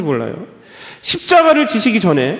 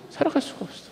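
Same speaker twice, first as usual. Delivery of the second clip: whispered echo; 4 semitones higher, 14 dB quieter, faster than usual. Shown as a demonstration. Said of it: "우리가 살아갈 수가 없어요".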